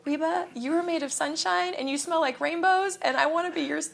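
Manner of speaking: high, gushing mock fangirl, climbing on daughter; gushing fan. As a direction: overdo the adoring superfan. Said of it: "And I didn't say that".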